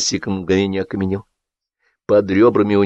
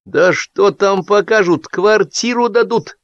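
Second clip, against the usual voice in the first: speculating; 11 semitones higher, slower than usual. A narrator's reading. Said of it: "предположила Драгунская".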